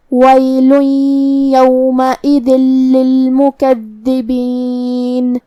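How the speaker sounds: slow melodic tajweed chant; Quran recitation. Acted. Teacher recites the verses as a model. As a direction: recite it slowly, drawing out the vowels.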